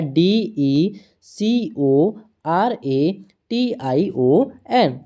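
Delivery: slow, evenly spaced letter-by-letter spelling; teacher dictating letters one at a time. Shown as a demonstration. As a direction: read each letter out slowly for the class. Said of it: "क्या आता है".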